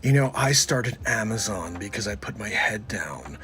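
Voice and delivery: hushed deep confident tone; Bezos impression. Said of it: "It's Bezos".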